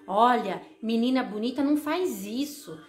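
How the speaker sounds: imitated mother laying down rules; insistent, repetitive mock-parental admonishing; scolding mother. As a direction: list the prohibitions like a lecturing mom. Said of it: "a sua mãe falava assim pra você".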